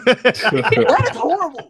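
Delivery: loud, high, drawn-out cry of horror; laughing outrage. Real, not acted. Friend reacting to disliked Southern Cannibals videos, laughing outrage. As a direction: exclaim it slowly through laughter.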